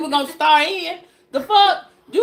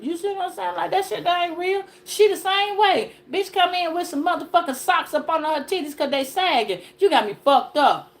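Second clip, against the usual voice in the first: normal talking voice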